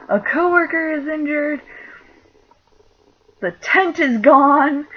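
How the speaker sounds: high and loud, the last words slow; urgent radio call. Retold or imitated. Coworker radioing the store after the tent collapse, an urgent high call. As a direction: report the emergency over the walkie-talkie.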